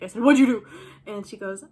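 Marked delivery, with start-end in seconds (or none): 0.2-0.7 loud, sudden outburst; retold loud, alarmed question; alarmed demand. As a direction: blurt it out at a friend's confession. Reacting to friend's confession.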